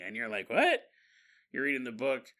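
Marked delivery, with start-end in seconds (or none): 0.5-0.8 confused what. Retold or imitated. Reader confused by spoiler.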